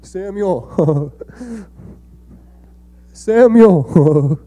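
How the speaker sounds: high, playful mock-spooky call of the name; prankster God calling. Pastor imitates God pranking Samuel, a high teasing call. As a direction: tease from behind the tent in a sing-song call. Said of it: "God wasn't hiding behind Samuel's tent like".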